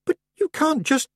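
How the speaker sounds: put-on a touch of a flustered girl; young girl. A narrator's reading. Tiffany brushes off the witch's teasing, lightly defensive girl.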